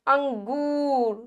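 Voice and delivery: अंगूर slow with गू held; long-vowel अंगूर demo; long oo stretched. Shown as a demonstration. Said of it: "हम ग की गू खींच रहे हैं".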